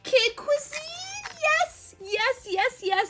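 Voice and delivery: high, loud joyful yes yes yes; real squeal of delight at finally getting it; excited cheering. Real, not acted. Unboxing her wished-for Kid Cuisine toy, excited cheering. Finds the toy she wanted.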